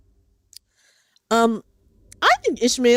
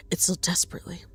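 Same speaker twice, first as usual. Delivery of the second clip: hushed, breathless whisper; 4 semitones lower, 9 dB quieter than usual. Acted. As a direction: drop to a desperate whisper. Whispering along with game dialogue.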